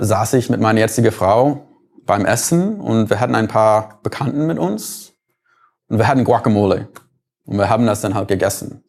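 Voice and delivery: normal talking voice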